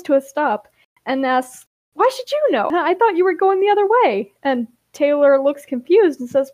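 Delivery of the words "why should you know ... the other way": high-pitched, flustered retort; startled, indignant comeback; defensive surprise. A narrator's reading. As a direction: challenge them, voice jumping up. Startled character challenges stranger.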